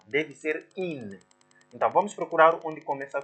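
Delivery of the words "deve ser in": deve ser in, slowed right down with in set apart; teacher correcting a pupil's error, slow and clear; slow correction. Shown as a demonstration. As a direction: spell out the right answer slowly. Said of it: "Enquanto não".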